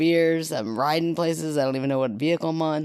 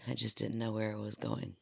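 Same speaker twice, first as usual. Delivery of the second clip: ordinary talk, nothing marked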